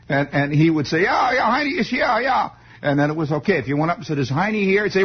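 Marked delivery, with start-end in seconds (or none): 1.0-2.5 high, excitable Bavarian German impression; German accent. Mister Falpo answering the kid, high mock German accent. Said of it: "rotten Bavarian German accent".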